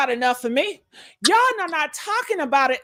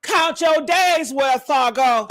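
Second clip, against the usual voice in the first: usual speaking voice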